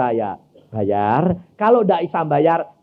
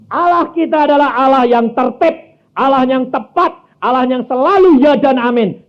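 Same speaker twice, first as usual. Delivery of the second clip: high, loud pulpit proclamation; full-force preaching; 11 semitones higher, 8 dB louder than usual. Acted. Preacher driving the point home to the church, loud preaching. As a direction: preach it out to the congregation.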